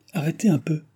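lightly voiced cry to halt; calling out. A narrator's reading. Baron halting the postilion, mildly calling out. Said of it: "s'écria monsieur de Tavernet au postillon".

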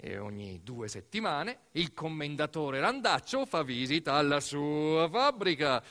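normal talking voice

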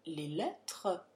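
usual speaking voice

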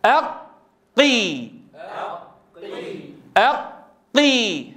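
أقي with a long silent hold on ق before the vowel; lengthened doubled qaf. Shown as a demonstration. Teacher warning about breath on kasra, long-held ق in أقي. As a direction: stay shut on ق, then release أقي without breath. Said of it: "أنا أطول الزمن شيئًا، في هذا المشدد".

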